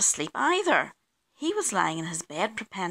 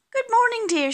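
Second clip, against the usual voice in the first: high, louder sing-out; cheery greeting; 7 dB louder than usual. A narrator's reading. Missus Bear greets Mister Bear on waking, cheery and high. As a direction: greet the morning in a lifted voice.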